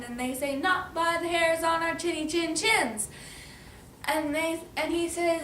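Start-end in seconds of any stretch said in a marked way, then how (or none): 0.6-3.1 higher sing-song chant; defiant storybook pigs. Acted. Pigs answer wolf from hiding, chanted defiance. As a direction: sing the refusal back.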